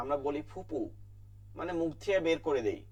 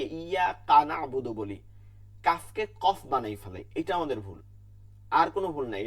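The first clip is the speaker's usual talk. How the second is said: ordinary delivery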